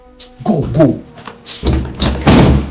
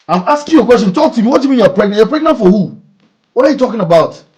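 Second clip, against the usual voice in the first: loud, high furious yelling at her; angry shouting; 8 semitones higher, 11 dB louder than usual. Acted. Man shouting at Bella over the pregnancy, furious. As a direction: shout the questions at her in outrage.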